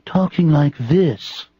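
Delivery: a hint of a put-on highbrow voice; mock affected intellectual. Shown as a demonstration. Host mocking pseudo-intellectuals, a touch of affected accent. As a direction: lightly mimic a pretentious intellectual. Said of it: "pseudo-intellectuals who are just into talking".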